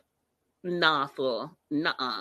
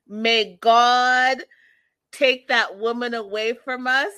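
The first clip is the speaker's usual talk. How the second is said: louder, hammed-up indignant prayer; mock outraged sister; 4 semitones higher, 5 dB louder, slower than usual. Sister talking crazy to the mom, louder mock outrage. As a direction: call down God on her, mock dramatic. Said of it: "talking crazy to the mom".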